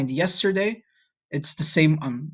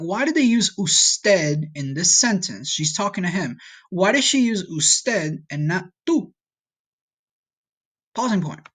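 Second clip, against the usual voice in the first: ordinary delivery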